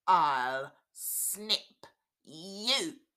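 very slow, loud snipping threat; menacing boy. Acted. Boy with scissors threatening the dolls, very slow and loud. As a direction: stretch each word out like a threat. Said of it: "he said".

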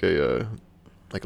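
ordinary talk, nothing marked

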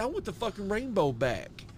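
high, hammed-up comedian punchline; Ralphie May. Retelling a comic's punchline, high mimicked voice. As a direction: land it in an imitated stand-up voice, way up. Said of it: "He said".

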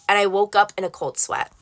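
ordinary delivery

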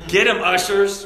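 loud, high mock order to seize him; mock shout. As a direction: play a leader sending the ushers in, loud and high. Mock church police order.

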